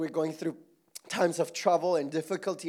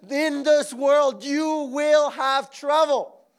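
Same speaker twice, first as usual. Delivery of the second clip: slow proclaiming; 8 semitones higher, 7 dB louder, slower than usual. Acted. Slow emphatic quote.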